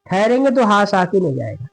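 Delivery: ordinary talk, nothing marked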